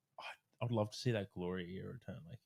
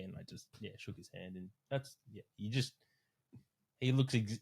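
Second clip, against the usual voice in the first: ordinary delivery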